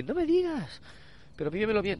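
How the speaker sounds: high, thrilled squeal; excited girlfriend. Retold or imitated. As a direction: gush with delight at the surprise. Girlfriend voiced thrilled proposal.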